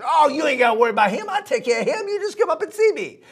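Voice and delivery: high, fast, eager sweet-talk; excited little dog luring the gator; coaxing dog voice. Acted. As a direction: rush to reassure him, way up high.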